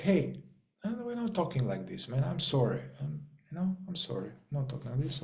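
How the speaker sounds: gentle inner coach. Retold or imitated. Inner coach catching you being nasty, soft and gentle. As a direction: hush the harsh self-talk tenderly. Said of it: "You're like".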